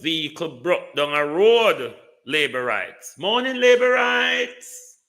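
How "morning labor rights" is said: much higher and louder, hammed-up hello; a loud, mocking good-morning; mock hearty greeting. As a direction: call it out like a mocking street greeting. Mock greeting, plain then shouted.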